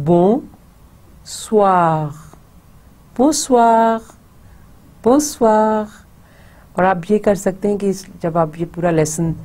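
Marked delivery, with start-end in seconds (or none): 0.1-5.9 Bonsoir said in French, bɔ̃-swaʁ; French model word. Shown as a demonstration. Teacher models Bonsoir for students to repeat. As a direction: model Bonsoir clearly, nasal first syllable. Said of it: "écoutez et répétez".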